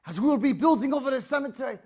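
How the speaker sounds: loud commanding governor proclaiming an order; stern official decree. Acted. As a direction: read the king's order with authority. Governor reads the king's order.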